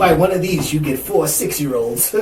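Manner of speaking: cheesy salesman pitch; ad pitchman. Pretend. Spoof ad pitch.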